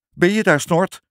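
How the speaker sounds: high, loud nervous question; nervous call. A narrator's reading. Trapped father calling out to his son Snort, nervous. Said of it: "riep hij zenuwachtig".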